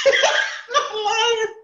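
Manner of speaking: high, drawn-out words through laughter; real laughing. Real, not acted. Friend swearing the story is true, cracking up. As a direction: squeeze it out while cracking up.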